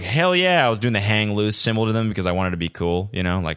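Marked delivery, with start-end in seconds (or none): 0.0-0.7 higher, pumped-up hell yeah; excited shout back to the crowd; mock cheer. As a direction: play the cool runner firing back at fans.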